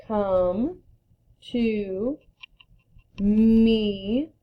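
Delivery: each word alone with pauses; slow word-by-word read. Shown as a demonstration. Teacher modeling slow reading before the fast version. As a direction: read it slowly one word at a time. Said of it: "Read it fast".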